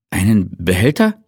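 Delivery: Behälter lifted into a baffled question; puzzled echo. A narrator's reading. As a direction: repeat it as if you misheard. Colleague echoes puzzled.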